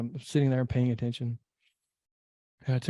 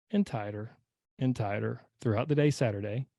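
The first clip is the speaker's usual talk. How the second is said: ordinary talk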